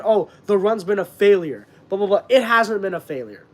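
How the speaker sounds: ordinary delivery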